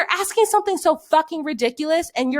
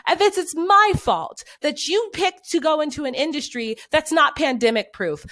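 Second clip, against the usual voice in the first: usual speaking voice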